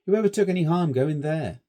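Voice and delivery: Stanley brushing off the warning; brash schoolboy. A narrator's reading. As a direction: scoff at the danger. Stanley challenges.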